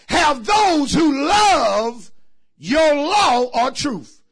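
loud high shouted call to the congregation; preaching at full force. Acted. Preacher rousing the church, full-force shouting. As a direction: whoop it out, stretching words.

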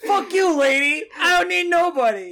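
laughing mock yell. Guy yelling, laughing.

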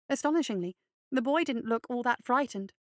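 ordinary delivery

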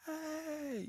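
high, drawn-out mock yell, kept soft. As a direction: imitate a far-off shout for someone's attention. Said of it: "a little bit more urgent".

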